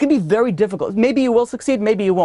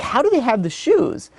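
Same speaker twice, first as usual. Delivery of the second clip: higher-pitched, acted bewilderment; retold baffled question; puzzled; 5 semitones higher than usual. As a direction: wonder aloud in mild disbelief. Prisoner puzzled by the shoes.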